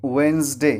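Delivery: "वेडनेसडे" as wenz-day, d silent; correct pronunciation. Shown as a demonstration. Teacher answers own question, correct "wenz-day". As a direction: say "वेडनेसडे" in two syllables with buzzing z. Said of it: "क्या बोलेंगे?".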